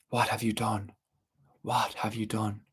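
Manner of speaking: a quiet reproach from a spirit; reproachful spirit. A narrator's reading. Water spirit reproaching the druid, softly reproachful. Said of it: "he could hear the reproach in this water spirit's voice".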